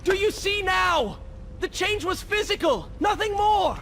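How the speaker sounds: very high, strained appeal; desperate insistence. Acted. Prince insists his change was physical, desperate high voice. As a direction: urge belief at the top of the range.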